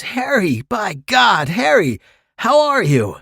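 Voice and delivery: put-on high, cheery hail of an old friend; hearty greeting. James hailing his old friend Harry, high and delighted.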